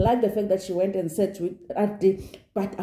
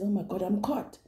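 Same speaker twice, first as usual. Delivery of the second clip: hushed panic; 8 dB quieter than usual. Retold or imitated. Voicing castmate's inner panic.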